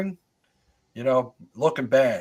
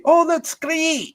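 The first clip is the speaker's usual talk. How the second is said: high, loud, slow Scottish exclamation; hammed-up Scottish cry with a rolled R; thick Scottish accent; 5 dB louder, slower than usual. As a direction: imitate Scottish friends, voice pitched way up. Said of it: "they have the thickest Scottish accent. They say".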